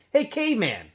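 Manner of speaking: high, loud call across the camp. A narrator's reading. Armpit calling out to Stanley across the holes, loud and high. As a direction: shout it out to a buddy.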